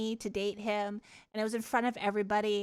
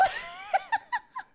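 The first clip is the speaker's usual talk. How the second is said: high squealing laugh. Real, not acted. Streamer retelling her first kiss, squealing laughter. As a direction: cackle helplessly at the memory.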